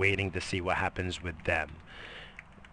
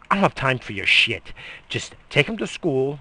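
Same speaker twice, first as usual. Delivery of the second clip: mock wife snapping; 5 semitones higher, 7 dB louder than usual. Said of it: "She's like".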